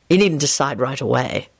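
understated sternness, a little low; gently firm instruction; stern. A narrator's reading. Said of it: "he said sternly".

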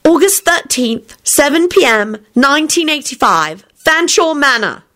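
slow, high, deliberate; slow announcement. Acted. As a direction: announce each part slowly. Announcing the date.

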